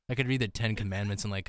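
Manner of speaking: ordinary delivery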